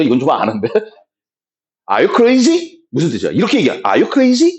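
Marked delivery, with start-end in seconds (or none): 1.9-2.8 English read out as a polite rising question; neutral textbook reading. Shown as a demonstration. Teacher showing students how not to say it, textbook question. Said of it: "이게 아니야". 3.8-4.5 higher, polite rising question; neutral textbook reading. Shown as a demonstration. Teacher showing the wrong way to say it, textbook question. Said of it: "이게 아니야".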